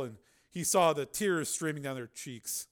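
ordinary talk, nothing marked

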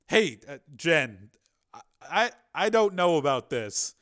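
worried and faltering; uneasy. A narrator's reading. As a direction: stumble into a nervous objection. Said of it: "Higgins said uneasily".

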